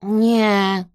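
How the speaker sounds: loud, grumpy refusal; Kresek grunting. A narrator's reading. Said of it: "burknął Kresek".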